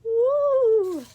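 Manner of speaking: high loud whoop; whooping as they run; cheer. Acted. Cheering escape.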